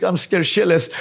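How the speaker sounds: ordinary delivery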